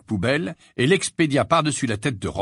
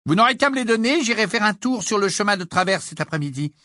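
high, lilting motherly voice; busy mother figure; 9 semitones higher than usual. A narrator's reading. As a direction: chat briskly as a mother coming in.

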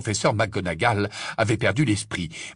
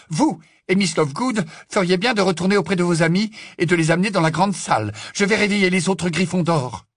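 higher-pitched brisk instructions; stern professor, urgent; 8 semitones higher, 3 dB louder than usual. Acted. Professor sending Potter back to his friends, stern and urgent. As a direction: give orders with urgency.

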